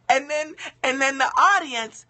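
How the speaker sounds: normal talking voice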